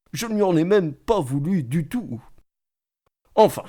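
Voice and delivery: usual speaking voice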